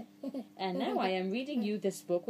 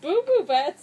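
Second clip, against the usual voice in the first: much higher and louder; silly loud name; 7 semitones higher, 8 dB louder than usual. Acted. Reader relishing the silly name, loud and high.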